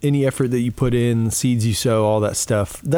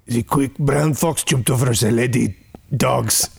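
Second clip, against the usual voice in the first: put-on German accent; amateur German-accented reading. Shown as a demonstration. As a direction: try the sentence in a German accent. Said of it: "your first accent is German".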